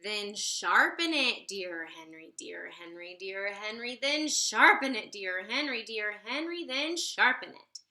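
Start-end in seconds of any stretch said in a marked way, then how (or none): none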